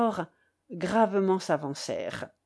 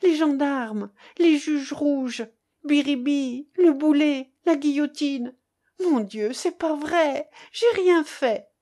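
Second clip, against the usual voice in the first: put-on high, anguished child's voice; frightened boy protesting his innocence; panicked boy; 6 semitones higher, 4 dB louder than usual. A narrator's reading. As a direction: plead in feverish dread, pitched high. Feverish boy panicking about gendarmes.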